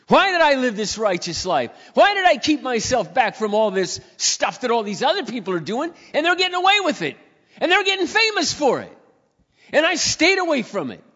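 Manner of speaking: high, loud, rising preacher's cry; full-force preaching. Acted. Preaching the psalmist's complaint.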